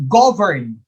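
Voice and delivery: GOV punched in "govern", second syllable falling away; first-syllable stress. Shown as a demonstration. Teacher sounding out an option for students, stress on first syllable. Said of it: "First syllable".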